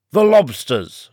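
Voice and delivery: acted shout, cutting in. A narrator's reading. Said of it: "shouted the Griffin".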